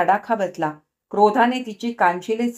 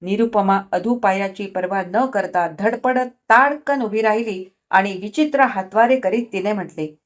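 ordinary delivery, nothing marked

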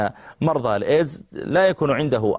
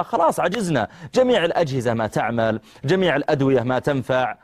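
ordinary talk, nothing marked

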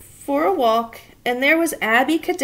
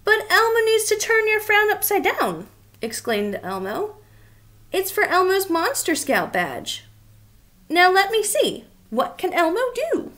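higher excited little monster; high eager Elmo explaining; 6 semitones higher than usual. Acted.

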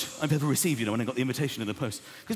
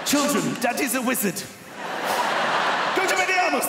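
high, slow, loud wizard proclamation; mock grand announcement; 10 semitones higher, 5 dB louder, much slower than usual. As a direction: declare it to the children like a great wizard. Proud dad announcing to kids.

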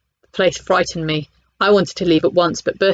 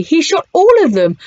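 much higher and louder, aghast; appalled disbelief; 10 semitones higher, 5 dB louder than usual. Acted. Bertie horrified the animals were shot, much higher cry. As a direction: cry out in horror. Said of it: "cried Bertie".

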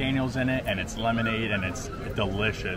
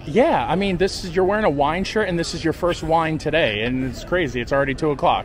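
loud teasing; 5 semitones higher, 6 dB louder than usual. Real, not acted. Teasing wife about wine.

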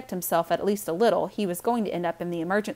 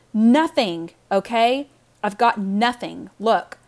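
loud, angry burst; angry outburst; 3 semitones higher, 7 dB louder, slower than usual. Acted. Brother snapping at his sister over the money, loud and angry. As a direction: blurt it out loud and fed up.

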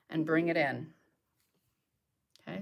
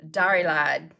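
"Diary lied" said louder with a light Southern accent; 2 semitones lower, 7 dB louder than usual. Shown as a demonstration. Painter jokes about a paint name in a light Southern accent. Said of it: "it just sounds better when you say it with a Southern accent".

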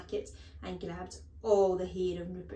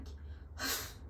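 short scornful snort; 5 dB quieter than usual. A narrator's reading. Headmistress taken aback, snorting. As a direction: huff of contempt through the nose. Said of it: "She snorted".

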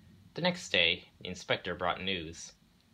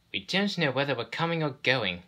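higher-pitched protest from flustered coaches; 7 semitones higher, 4 dB louder than usual. A narrator's reading. Overworked coaches complain to the railway, protesting. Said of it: "they protested".

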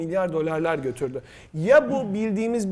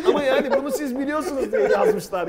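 stifled giggles; 6 semitones higher, 3 dB louder than usual. Real, not acted. Host laughing at question writers.

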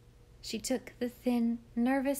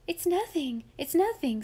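higher, brisk impatient dismissal; rather impatient; 8 semitones higher, 4 dB louder than usual. A narrator's reading. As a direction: brush off the fear impatiently. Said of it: "rather impatiently".

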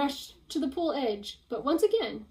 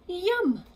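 gasp then yum; 7 semitones higher, 3 dB louder than usual. Acted. Spike catches candy fish.

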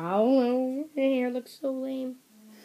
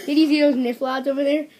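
laughing; 5 semitones higher, 7 dB louder, faster than usual. Real, not acted. Giggling over a made-up word.

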